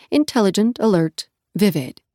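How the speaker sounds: plain reading voice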